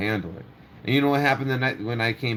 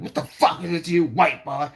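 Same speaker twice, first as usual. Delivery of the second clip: put-on hostile girl quote; 6 semitones higher, 3 dB louder than usual. Girl's hostile retort, retold.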